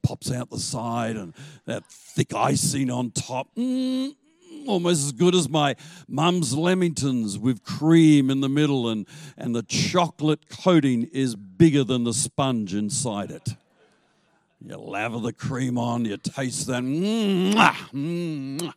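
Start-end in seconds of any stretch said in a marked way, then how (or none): 3.5-4.2 savouring mm. Acted. Savouring vanilla slice. 16.8-18.7 savouring mm and kisses. Acted. Savouring lamingtons, chef's kiss.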